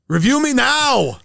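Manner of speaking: high, slow, loud hammed-up action hero; mock Schwarzenegger. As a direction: bark it like a movie villain, slowly. Total Recall parody.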